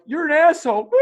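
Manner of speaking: high, loud comic accusation; acted outraged yelp; mock angry outburst. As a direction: hurl the insult, about to burst out laughing. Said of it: "I'm gonna have you angry".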